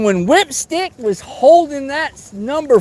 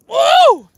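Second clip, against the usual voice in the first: excited whoop; 4 dB louder than usual. Real, not acted. Lands a fish.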